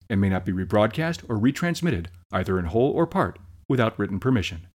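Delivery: soft, fast fine-print read; quick legal disclaimer. Acted. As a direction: rattle off the disclaimer quietly.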